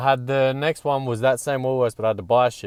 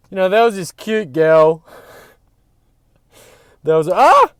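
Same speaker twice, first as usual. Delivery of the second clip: higher and louder, bursting with excitement; giddy squeal breaking off on ah; excited; 7 semitones higher, 8 dB louder than usual. Real, not acted. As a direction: blurt it out, too excited to finish. Excited about cute girl.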